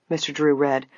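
ordinary delivery, nothing marked

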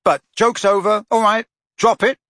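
impatient. A narrator's reading. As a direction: shut the joke down sharply. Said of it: "said Harry impatiently".

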